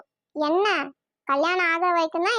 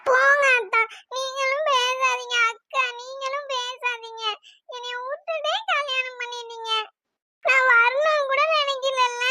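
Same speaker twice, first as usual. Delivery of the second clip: tearful complaint; 4 semitones higher than usual. Acted. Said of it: "கனி அழுதுட்டு இருக்கா".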